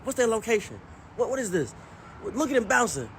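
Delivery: mock granddad firing off questions; excited granddad. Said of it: "He said".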